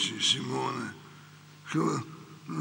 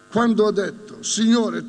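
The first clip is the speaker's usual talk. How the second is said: higher and louder, swelling with fervour; loud second take; 4 semitones higher, 6 dB louder than usual. Acted. Preacher to congregation, building to a loud cry. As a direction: repeat it now with full force.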